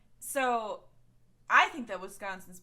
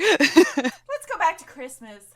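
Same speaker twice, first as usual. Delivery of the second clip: loud; 5 semitones higher, 14 dB louder, slower than usual. Real, not acted. Changing subject loudly.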